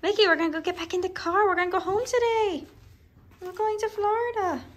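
high, soft sing-song to a pet; pet talk. Real, not acted. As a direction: coo the plan to your pet. Talking sweetly to the pet Mickey.

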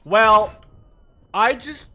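very high, loud, stretched shout; overwhelmed by a huge streak; ecstatic. Real, not acted. Gamer shouts to friend after ten kills, ecstatic. Sudden multikill excitement.

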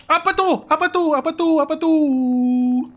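high, loud cries repeated in fright; panicked screaming. Real, not acted. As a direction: shriek in panic, drawing out the last one. Screaming at a ghost jump scare. (teriak panik ketakutan)

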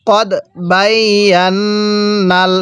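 কদ বাইয়ান্নাল with a long held n; measured model reading of কদ বাইয়ান্নাল; slow tajweed recitation. Shown as a demonstration. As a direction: stretch the doubled n in বাইয়ান্নাল. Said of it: "কিভাবে পড়ব?".

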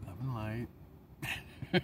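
drawn-out mock guru calm; mock spiritual blessing. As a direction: draw it out like a serene guru. Self-mocking spiritual sign-off.

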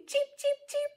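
tiny high, soft cheeps; chick cheeping. Shown as a demonstration. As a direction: imitate baby chicks. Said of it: "They go".

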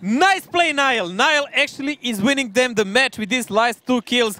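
high, loud excited yell; excited shout. Real, not acted. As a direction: shout it like a huge play just landed. Caster erupts at a big play.

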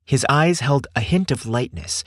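ordinary delivery, nothing marked

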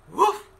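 storybook dog woof. Acted. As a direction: bark a friendly woof. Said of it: "said Dog".

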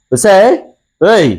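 impatient high-pitched calls; shouting for his wife. Acted. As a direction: holler to summon her. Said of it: "ఏంటండి అలా అరుస్తున్నారు?". (గట్టిగా కేకేసి పిలవడం)

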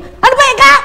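retold abrupt yell; sudden startling shout. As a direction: burst out to jolt someone from their thoughts.

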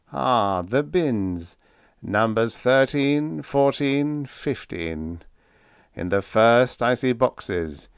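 slow, trance-like clairvoyant; lifted, drawn-out psychic trance voice; droning. A narrator's reading. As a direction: drone it out slowly as if seeing it. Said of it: "droned Thaxton".

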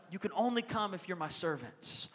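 soft, understated mock bossiness; quiet child's condition. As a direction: lay down terms very quietly, as a retold kid. Little boy bargaining with brothers.

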